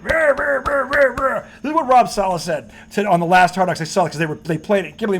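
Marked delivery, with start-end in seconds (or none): 0.0-1.4 higher-pitched wordless rah-rah noise; mock cheering. Host mimicking TV pundits gushing over the coach, mocking rah-rah. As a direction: imitate gushing fans as blurted babble. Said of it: "they're like".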